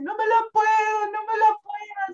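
high, loud whimpering wail of not coping; imitating crying despair. Exhausted mother on the stairs, imitating her own despairing wail. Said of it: "y el mayor al lado mío y llorando".

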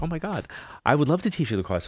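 high, gushing mock excitement; excited gush. Telling his friend he'd love to teach her, excited. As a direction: squeal it like a thrilled student. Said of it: "So now I'm all excited".

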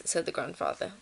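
ordinary delivery

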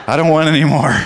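laughing through a loud joking reply; telling dad he's had enough Wednesdays, laughing; laughing punchline. Retold or imitated. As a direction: crack up while delivering the joke to dad.

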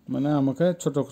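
normal talking voice

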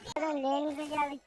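ordinary delivery

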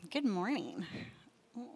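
ordinary talk, nothing marked

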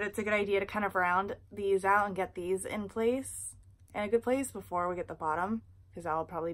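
talking with finger at mouth; finger on lip. Real, not acted. Seen 0: Finger pulls at lip/teeth showing braces through most frames.

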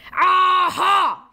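big high shout as the genie; booming genie shout. Acted. Genie bursting out at the children, shouting. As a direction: bellow it like a villain appearing. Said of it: "he shouted".

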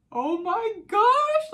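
mother seeing her newborn, high, loud and drawn out; overwhelmed awe. Retold or imitated. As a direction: gasp it out at first sight of the baby. First sight of newborn.

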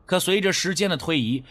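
ordinary delivery

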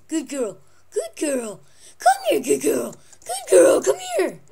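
high, loud squeaky pet praise. Real, not acted. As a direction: gush over the good dog.

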